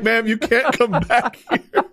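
high, slow laughing imitation; hospital staff. Hospital staff stopping mom with her camera, slow mock protest. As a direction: draw out the refusal through a laugh. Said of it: "And they're like".

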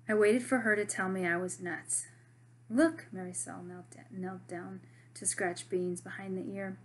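lively read-aloud of a novel chapter; story reading. A narrator's reading. Teacher reading a novel chapter to her students, animated. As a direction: read the chapter to the class with voices.

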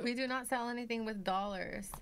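normal talking voice

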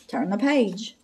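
ordinary delivery, nothing marked

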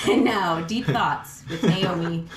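slow, grand announcer intro; mock announcer. Mock TV intro about her own musings, slow and grand. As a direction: announce the segment like a TV host.

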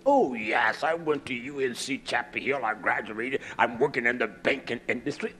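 a hint of a smug banker bragging; boasting graduate. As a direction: play the proud grad, pleased with himself.

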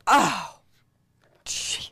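ordinary talk

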